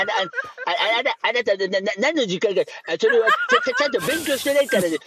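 put-on foreign-accented stammering Japanese, a touch flustered; Zomahon impression. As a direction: lecture earnestly in a foreign accent. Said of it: "ゾマホンです".

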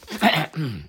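sudden cough mid-sentence; coughing fit. Real, not acted. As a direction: break off with a real cough. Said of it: "I'm coughing".

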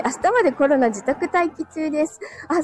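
ordinary talk

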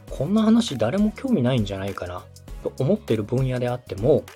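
usual speaking voice

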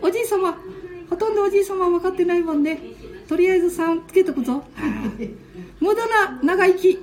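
put-on high, playful little-boy voice; squeaky grandkid voice; child voice. Ken-chan teasing grandpa.

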